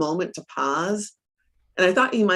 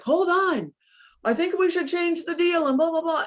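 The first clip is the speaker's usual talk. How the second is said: much higher hammed-up husband cutting in; mock husband; 12 semitones higher than usual. Wife mocking husband halting the property deal, much higher mock voice. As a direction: mimic him barging in, pitched way up. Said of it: "And he's like".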